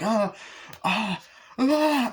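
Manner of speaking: high, loud imitated effort cries; stabbing yells. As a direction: separate yells with each stab.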